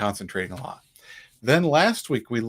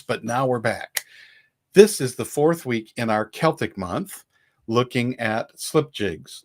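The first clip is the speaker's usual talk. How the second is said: normal talking voice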